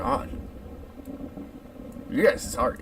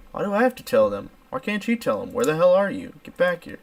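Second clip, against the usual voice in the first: higher, louder exasperated questions; mock indignation; 6 semitones higher, 5 dB louder than usual. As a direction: playfully scold an absent sibling. Indignant reply to sibling's note.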